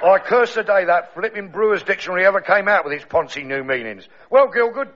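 lightly voiced Cockney pearly king, grumbling; a hint of a Cockney king moaning about his alarm clock. Pearly king grumbling to his wife Else, Cockney.

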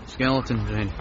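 quiet mumble; barely audible mumble; mumbled. Acted. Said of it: "still not quite catching that".

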